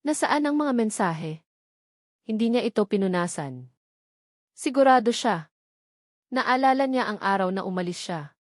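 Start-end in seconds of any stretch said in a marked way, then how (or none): none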